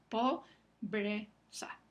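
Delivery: "pobreza" very slowly as po-bre-za; teacher spelling out "pobreza" syllable by syllable; slow syllabified. Shown as a demonstration. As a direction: break "pobreza" into po…bre…za deliberately. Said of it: "Las dos consonantes juntas".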